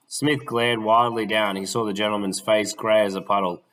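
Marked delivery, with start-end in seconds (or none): none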